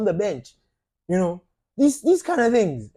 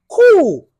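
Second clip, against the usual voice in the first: sarcastic loud cool; 11 semitones higher, 11 dB louder than usual. Real, not acted. Ranting at demanding fans.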